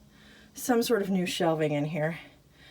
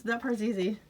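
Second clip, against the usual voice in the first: ordinary delivery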